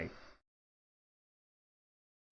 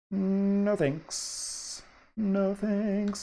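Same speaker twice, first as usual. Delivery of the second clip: high, chirpy refusal voice; Skids; 9 semitones higher, much slower than usual. Acted. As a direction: brush it off in a character voice. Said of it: "says Skids oh two".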